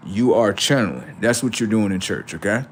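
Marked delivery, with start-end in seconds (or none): none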